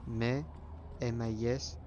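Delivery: ordinary delivery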